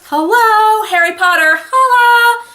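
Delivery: high, loud sing-song "hello"; loud, drawn-out mock disbelief; incredulous hello. Real, not acted. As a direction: call it out as if stating the glaringly obvious. Incredulous calling out over famous author.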